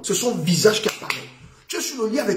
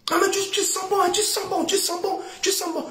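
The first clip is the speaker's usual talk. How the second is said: imitated admirer; 10 semitones higher, 4 dB quieter than usual. Imitating someone smelling perfume.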